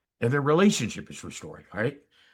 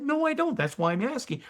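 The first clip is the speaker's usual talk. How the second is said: much higher, exasperated no; heated denial; 14 semitones higher than usual. Retold or imitated. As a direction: insist you don't know, rising in frustration. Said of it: "We're having an argument".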